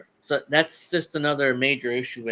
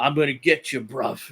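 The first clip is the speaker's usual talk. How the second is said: lightly imitated thick British accent, London bruv slang; a passing stab at a British accent; mock British accent. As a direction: take off a British wrestler's accent, half-joking. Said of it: "he has that thick British accent, you know, like that".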